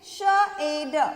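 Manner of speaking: श ए ड sounded out separately; letter sounds. Shown as a demonstration. Teacher showing children Hindi sounds, श ए ड said sound by sound. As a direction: break the word into three sounds, श ए ड. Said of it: "कैसे बोलेंगे हिंदी में".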